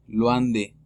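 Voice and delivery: "loin de" said "lwan de". Shown as a demonstration. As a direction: glide into wa in "loin". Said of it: "La O con I es wa". (loin de pronunciado con wa)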